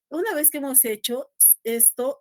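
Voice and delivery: normal talking voice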